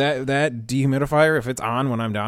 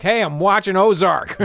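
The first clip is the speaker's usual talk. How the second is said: louder, higher mock complaint; irritated call, raised; annoyed neighbor; 9 semitones higher, 6 dB louder than usual. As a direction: snap at the noisy neighbor. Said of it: "now we're all like".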